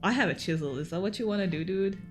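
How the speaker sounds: ordinary talk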